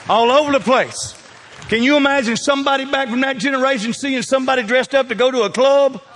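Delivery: rising from lively to a full shouted climax; building comic shout. Acted. Preacher hams up old folks exploding, building to a shout. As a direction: let the pitch climb until the boom bursts out.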